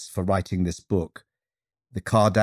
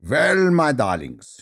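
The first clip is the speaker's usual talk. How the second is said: slow, grand Russian drawl; drawn-out, higher Russian vowels; rich Russian accent; 5 semitones higher, slower than usual. Acted. Movement teacher addressing her new class, rich Russian accent. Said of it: "speaking with rich Russian vowels".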